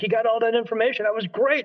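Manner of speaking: quick, high-pitched excited question; retold excitement. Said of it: "'Cause I was excited".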